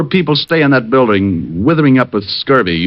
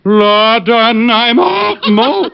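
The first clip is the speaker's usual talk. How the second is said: high warm-up; 8 semitones higher, slower than usual. Acted.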